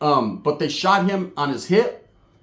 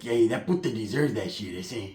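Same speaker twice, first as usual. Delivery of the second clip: imitating Pepe; 5 semitones lower, 6 dB quieter than usual. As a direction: drag it out, low and cold. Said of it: "He was like".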